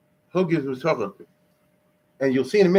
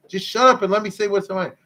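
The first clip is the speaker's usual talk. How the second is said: mildly exasperated, higher-pitched demand; indignant retort; 6 semitones higher than usual. Retold or imitated. Sufferer silencing those who object to his complaint, indignant retort. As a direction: insist on being allowed to speak.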